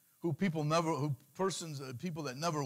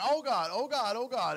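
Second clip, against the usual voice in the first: mock panic; 6 semitones higher, 4 dB louder than usual. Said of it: "And all of a sudden I'm like".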